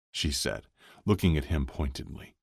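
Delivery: plain reading voice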